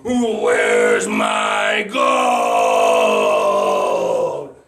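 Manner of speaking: enormous low roar, endlessly stretched; raging dragon. Acted. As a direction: roar it out as long and loud as possible. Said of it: "he was angry".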